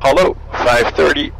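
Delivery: ordinary talk, nothing marked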